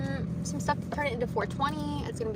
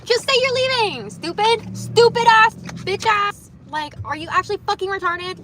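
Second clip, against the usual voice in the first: loud, higher mock screaming at a driver; road rage bit; 7 semitones higher, 9 dB louder than usual. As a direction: yell at the car in put-on road rage. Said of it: "The road rage bit".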